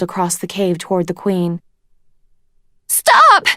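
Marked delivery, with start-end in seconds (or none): 2.9-3.5 high, loud shriek. A narrator's reading. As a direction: shriek it in panic. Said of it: "Sunny shrieked".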